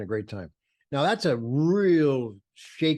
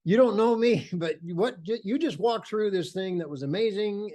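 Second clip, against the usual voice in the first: higher-pitched excited gush; enthusiastic approach; 7 semitones higher than usual. Retold or imitated. Photographer stopping a stranger in delight, excited. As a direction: re-enact a thrilled pitch to a stranger, voice raised high. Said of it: "I'm, I'm enthusiastic. I say".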